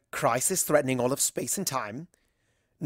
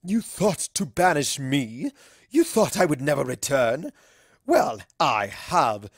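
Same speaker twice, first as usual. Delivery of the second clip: slow, booming menace building to a shout; gloat, then roar the last words; villain's return; 3 semitones higher, 7 dB louder, slower than usual. Acted. Dark pony on stage threatens the party, slow menace rising to a roar.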